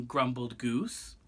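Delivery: usual speaking voice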